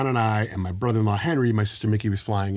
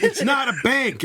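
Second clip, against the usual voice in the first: high-pitched mock exasperation, half laughing; exasperated; 14 semitones higher than usual. Husband rejecting wife's guess in Pictionary, exasperated. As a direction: retell a flustered protest.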